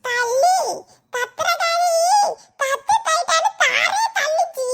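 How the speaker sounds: high, slow, laughing mangled voice; a high old uncle garbling "Dattu தைட்டான்னு"; old man's garbled speech. Acted. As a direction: drag the words out high and mangled. Old man mangles words again.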